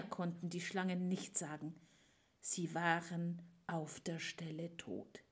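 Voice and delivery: hushed whisper. A narrator's reading. Narrator whispering that the snakes died. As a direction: drop to a whisper for the kill. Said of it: "whispered narration".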